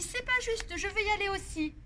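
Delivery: put-on high whiny toddler voice; whiny little boy. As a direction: complain like a small boy.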